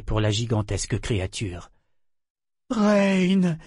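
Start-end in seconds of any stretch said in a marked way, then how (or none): 2.7-3.6 higher, drawn-out call of disbelief; incredulous. A narrator's reading. Mother finding her son before the dragon, incredulous. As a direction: call the name as a disbelieving question. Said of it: "demanda-t-elle, incrédule".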